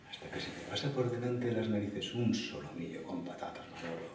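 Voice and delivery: hushed temptation. Acted. Telling Manolo about a tempting sirloin, hushed. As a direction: drop it low like a tempting secret.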